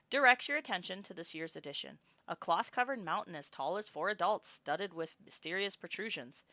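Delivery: ordinary delivery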